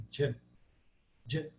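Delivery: "J" said as a short "djâ"; Sanskrit J sound. Shown as a demonstration. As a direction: voice "J" as D plus J, one syllable. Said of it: "o J tem som de". ("J" com som de "djâ")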